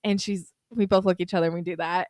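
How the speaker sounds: usual speaking voice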